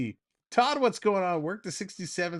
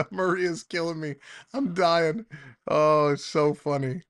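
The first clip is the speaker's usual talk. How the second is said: normal talking voice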